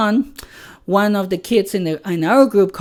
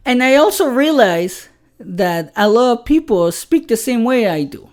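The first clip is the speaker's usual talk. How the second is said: ordinary talk, nothing marked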